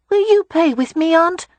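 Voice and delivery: put-on a boy begging his aunt to play; eager little boy. A narrator's reading.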